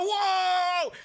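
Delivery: very high, loud hyped "Whoa!"; hammed-up excited yell; mock streamer whoa. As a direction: yell it like a sick kill. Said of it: "I'm gonna go".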